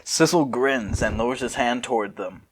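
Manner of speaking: normal talking voice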